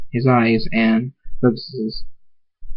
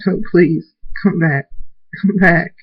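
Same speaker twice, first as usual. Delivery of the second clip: raised, urgent begging; desperate pleading; 7 semitones higher than usual. A narrator's reading. Son on his knees begs mother to come back, desperate pleading. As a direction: plead in a higher voice, breaking between phrases. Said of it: "drops to his knees and says".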